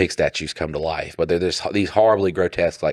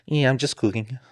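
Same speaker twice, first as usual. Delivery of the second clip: lightly higher, softer breezy quote of the friend; casual quote; 6 semitones higher, 5 dB quieter than usual. Retold or imitated. Quoting his friend the cook, breezy and soft. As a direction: toss it off like it's nothing.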